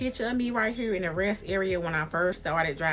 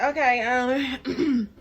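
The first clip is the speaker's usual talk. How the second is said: rough throat; 6 semitones higher, 4 dB louder, much slower than usual. Real, not acted. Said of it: "that didn't work for guys".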